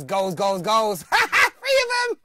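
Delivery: climbing to a high, loud, drawn-out cry; mock exasperation. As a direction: ham up the disbelief. Mock outrage at conceded goals.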